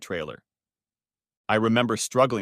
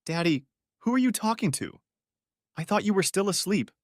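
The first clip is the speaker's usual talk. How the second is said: high-pitched voice of a puzzled daughter; daughter's voice; 10 semitones higher than usual. A narrator's reading. Daughter hears Daddy talking to someone, high puzzled voice. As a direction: lift it high, questioning Daddy.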